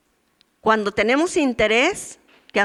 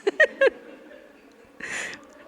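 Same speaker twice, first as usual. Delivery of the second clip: laughing through tears; 6 semitones higher, 5 dB louder than usual. Real, not acted. Laughing tearfully at own story.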